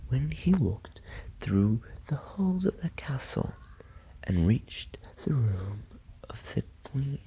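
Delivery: plain reading voice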